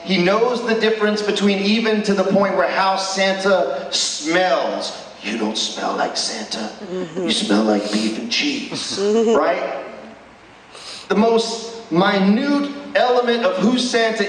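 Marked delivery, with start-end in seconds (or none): 5.2-9.0 lower, softer Buddy voice; imitating Buddy. Preacher retelling the film scene, lightly voicing Buddy. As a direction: mildly mimic Buddy's blunt accusation.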